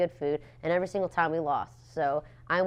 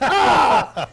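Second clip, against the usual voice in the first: high loud yelp of fake outrage; mock outraged yell; 7 semitones higher, 11 dB louder than usual. Sitting on the floor, a mock yell at losing. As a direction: let out a hammed-up protest cry.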